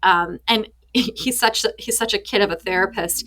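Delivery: normal talking voice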